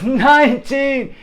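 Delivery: high, loud, stretched number; bullhorn counting. Retold or imitated. Teacher nearing twenty in her count, loud calling. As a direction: draw the number out as a warning call. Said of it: "she got her bullhorn".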